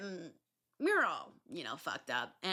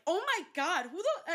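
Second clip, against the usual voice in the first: high, loud startled cry; stunned "who the" yelp; shocked outburst; 10 semitones higher, 4 dB louder than usual. Retold or imitated. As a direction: recoil in shock, half laughing. Shocked at stranger's kiss.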